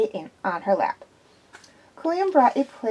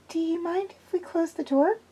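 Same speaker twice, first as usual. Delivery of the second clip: lightly raised, anxious woman's voice; nervous young woman; 7 semitones higher, 4 dB quieter than usual. A narrator's reading. Zoe tells Quillian she is nervous, lightly voiced anxious woman. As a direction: play Zoe uneasy and jumpy.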